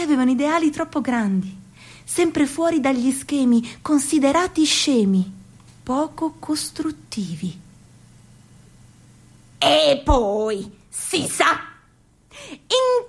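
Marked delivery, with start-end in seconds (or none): 5.8-7.6 sussurrato, voce senza tono; whisper. Acted. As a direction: whisper it like a secret. Whispered aside in sketch. (sussurrando piano)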